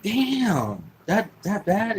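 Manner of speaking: an incredulous, high "damn, that bad?"; surprised damn. Retold reaction to tough kid.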